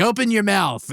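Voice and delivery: high-pitched mock command; old Sicilian lady. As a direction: order it like a no-nonsense matron. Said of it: "this old Sicilian lady was like".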